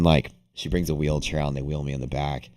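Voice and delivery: normal talking voice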